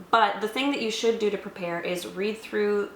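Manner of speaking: ordinary talk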